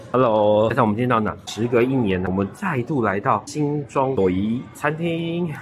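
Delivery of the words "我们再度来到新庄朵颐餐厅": normal talking voice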